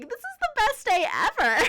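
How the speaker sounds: high, loud, gleeful squeal; excited. Retold or imitated. Store worker thrilled to witness a freak-out, excited squeal. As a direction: relive the thrill at full pitch. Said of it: "I'm, like, excited".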